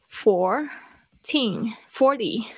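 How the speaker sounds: put-on "fourteen" slowly split with strong "teen", "forty" front-stressed; contrasting stress. Shown as a demonstration. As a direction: set slow "four-TEEN" against "FOR-ty". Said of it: "再多一个例子".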